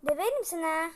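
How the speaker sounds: loud threatening tone; a loud playful threat; mock threat. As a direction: threaten the sibling loudly. Threatening the sibling.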